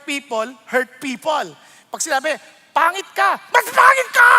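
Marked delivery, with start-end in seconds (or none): 2.7-3.4 raised, taunting jab; opening jab of a mock quarrel; mock insult. 3.5-4.3 high, loud shouted comeback; shouting back an even worse insult; mock shouted retort. Escalating retort in a quarrel.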